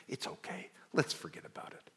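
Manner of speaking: put-on soft comforting whisper to a child. Parent comforting a crying child in a whisper. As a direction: hush them gently, almost no voice.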